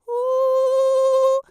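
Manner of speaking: trembling high howl with tight lips; shivery howl. Shown as a demonstration. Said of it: "make your voice sound shivery".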